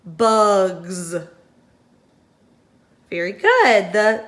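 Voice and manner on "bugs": "Bugs." with the final S buzzed as a long Z; buzzing Z ending. Shown as a demonstration. Teacher models the Z sound for the class. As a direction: lean on the buzz at the end of "Bugs.". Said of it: "What sound does it make on the end?".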